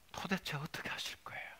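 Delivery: whispered, weary question to God; hushed question. Acted. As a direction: ask it under your breath. First question to God, hushed take. (속삭이듯 낮게 따져 물으며)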